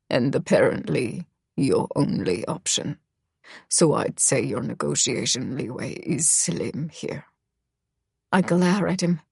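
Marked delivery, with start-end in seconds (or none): 0.1-7.3 Misha, low and cold, almost murmured; dropped low, a menacing murmur; low male voice. Acted. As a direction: voice the brooding boy low and quiet.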